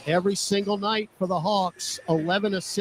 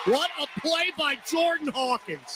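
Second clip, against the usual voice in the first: high-pitched burst of excitement; real excitement, pitched well up; excited play call; 9 semitones higher than usual. Real, not acted. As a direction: exclaim it like a thrilled sportscaster. Live call of a big play.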